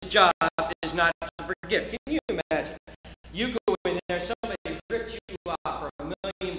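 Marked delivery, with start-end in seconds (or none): none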